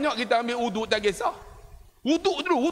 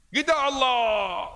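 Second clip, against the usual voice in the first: very slow, stretched Allah; drawn-out takbir; 5 semitones higher, much slower than usual. Retold or imitated. Preacher mocking the Malay way of stretching the takbir, slow. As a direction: drag the takbir out slowly. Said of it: "semayang maghrib lah tu".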